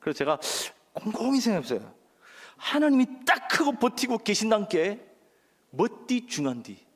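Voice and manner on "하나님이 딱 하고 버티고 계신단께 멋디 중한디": Jeolla dialect. Shown as a demonstration. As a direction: deliver the rehearsed Jeolla punchline. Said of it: "장모님 댁이 전라도입니다".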